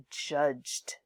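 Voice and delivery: "judged" with a J sound before the final D; soft J sound. Shown as a demonstration. Teacher contrasts it with hard G, "judged" said with J. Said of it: "It's a J sound".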